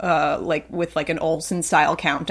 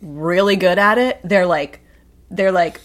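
usual speaking voice

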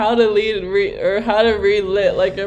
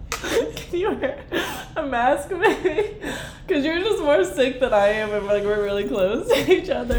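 high, giggling mock request; laughing mock complaint; 5 semitones higher, 4 dB quieter than usual. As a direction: tease through laughter. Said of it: "That was all just a little act".

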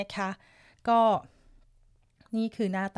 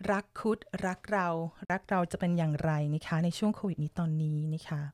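lower, softer sweet-talk voice, gently crooned; sweet radio voice; 6 semitones lower, 4 dB quieter than usual. Acted. Host reads a love topic to viewers, lower and softer, sweetly crooned. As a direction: croon it softly like a late-night radio host. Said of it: "เสียงเพราะมากค่ะคุณ[name]".

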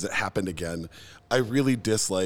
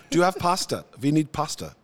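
put-on playful German accent, pitched up; 3 semitones higher than usual. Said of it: "that whole story is funnier in a German accent".

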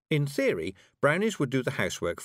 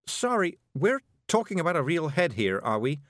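higher, understated horror; horrified; 7 semitones higher than usual. A narrator's reading. Miss Level checking that Rob means real heads, horrified. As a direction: check in disbelief, pitched up.

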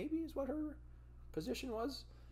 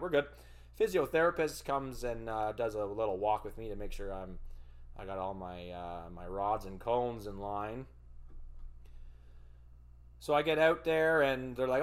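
usual speaking voice

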